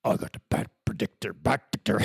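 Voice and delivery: low, unvoiced rasp; raspy masked-hero growl; Batman. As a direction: do a hushed, rasping superhero voice. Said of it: "Do you, Batman?".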